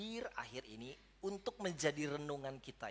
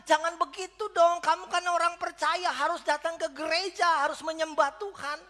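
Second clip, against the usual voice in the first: judgmental churchgoer; 11 semitones higher, 12 dB louder than usual. Retold or imitated. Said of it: "orang bilang".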